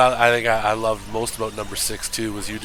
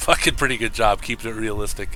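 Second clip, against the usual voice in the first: ordinary talk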